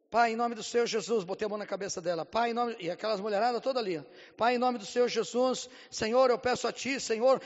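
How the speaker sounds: loud, fervent prayer, Senhor stretched. Retold or imitated. Pastor prays over the woman, loud fervent prayer. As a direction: call on God with full force.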